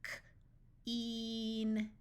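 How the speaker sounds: K alone, a pause, then a drawn-out "een"; sounded out. Shown as a demonstration. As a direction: break "K-een" into its sounds slowly. Phonics teacher sounds out, then blends.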